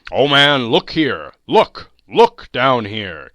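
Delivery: loud, urgent cries of look; booming spirit's exclamation; exclaiming ghost. A narrator's reading. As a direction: call out loudly, demanding attention. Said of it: "exclaimed the ghost".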